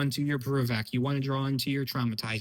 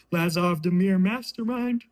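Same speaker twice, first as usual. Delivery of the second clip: real yawn stretching the words, higher and slow; 5 semitones higher, 4 dB louder, slower than usual. Real, not acted. Host reading a card name to viewers, yawning. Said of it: "Sorry, I yawned".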